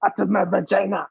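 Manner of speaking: mock French accent. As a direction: play a crude Frenchwoman in cartoon French. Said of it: "how we do it in French".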